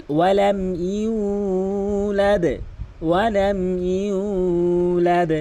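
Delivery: very slow melodic tilawat; slow Quran recitation. Shown as a demonstration. Teacher modelling correct recitation, slow chant. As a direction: draw each word out in chant. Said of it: "এভাবে তেলাওয়াত করবেন".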